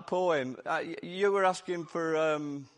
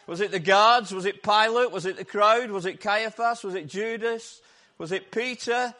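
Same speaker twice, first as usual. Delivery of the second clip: loud, higher rhetorical questions; rousing questions; 5 semitones higher, 9 dB louder than usual. Acted. Preacher firing questions at the congregation, loud and rousing. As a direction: fire each question at the church.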